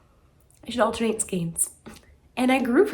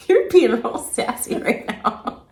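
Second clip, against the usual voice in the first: teasing scold; 2 semitones higher, 8 dB louder than usual. Real, not acted. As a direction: tease him for his sass. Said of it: "take off the sass".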